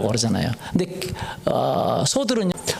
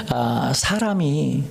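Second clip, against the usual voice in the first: normal talking voice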